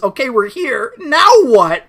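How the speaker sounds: rising to loud high question; voicing Rhea. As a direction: build up to a high, loud now what.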